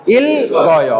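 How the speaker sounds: ইল গয় with a clear held l; il-goyo, both l and g heard; both consonants sounded. Shown as a demonstration. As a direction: say ইল, then গয় with g. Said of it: "এখন উচ্চারণ হবে".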